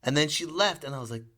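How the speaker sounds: normal talking voice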